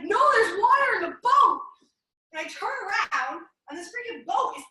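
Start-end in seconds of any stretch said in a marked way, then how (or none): 0.0-1.6 put-on high, loud child insisting; insistent five-year-old. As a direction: push it louder like a kid not being believed. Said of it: "And he's like".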